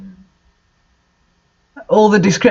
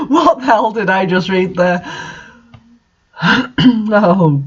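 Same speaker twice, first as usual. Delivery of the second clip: ordinary talk, nothing marked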